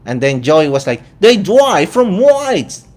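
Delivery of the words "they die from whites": put-on high, slow Australian accent, a bit louder; mock Australian accent. Vlogger mimics Joey's Australian accent to a TV host. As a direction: drawl it out like an Aussie guest. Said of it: "that Australian accent".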